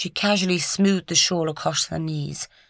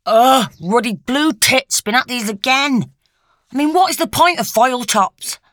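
loud, raised-pitch comic annoyance; exasperated grumble; 7 semitones higher, 6 dB louder than usual. A narrator's reading. Lucy shows the doctor an offending milk bottle, exasperated. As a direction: groan and complain with exasperation.

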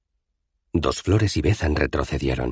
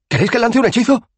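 high, loud, rapid offer; eager insistence; 6 dB louder, faster than usual. A narrator's reading. Rincewind offers a spell to his companions, fast and overexcited. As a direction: press them excitedly, voice way up. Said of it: "insistió Rincewind".

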